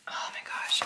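hushed unboxing whisper; soft breathy wrap-up whisper. Real, not acted. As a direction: whisper it like a secret.

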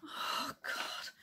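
hushed, breathy dismay; very soft, exasperated aside; despairing mutter. Acted. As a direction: groan softly under your breath. Private despair at another interruption.

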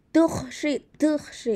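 "tuj, shi" with a hard popped T and a hissed sh; "tuj" spat out with a glottal catch; T with accent. Shown as a demonstration. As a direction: pop the T sharply in "tuj", then a hissed "shi". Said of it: "cuando pronuncias la T con acento".

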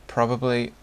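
ordinary talk

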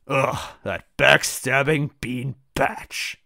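slow, drawn-out annoyed insult; simmering, stretched-out grievance; restrained disgust. Acted. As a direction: groan the insult slowly without shouting. First take of character's angry insult.

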